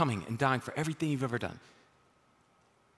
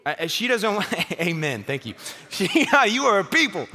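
laughing; 6 semitones higher, 6 dB louder than usual. Real, not acted. Crowd laughs along.

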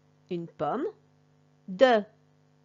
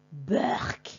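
disgusted reaction; 4 semitones lower than usual. Shown as a demonstration. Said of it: "you give the right reaction in French".

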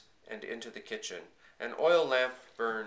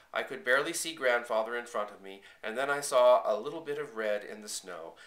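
plain reading voice

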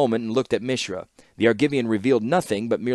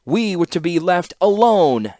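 slow, cold, louder and higher; stony; 8 semitones higher, 6 dB louder, slower than usual. A narrator's reading. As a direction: lay down the rule with cold anger. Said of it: "said Ashnod stonily".

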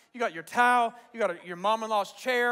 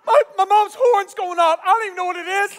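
higher, louder frantic plea; panicked teen on the phone; 7 semitones higher, 8 dB louder than usual. Retold or imitated. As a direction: beg the operator in a panic. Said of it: "I'm like".

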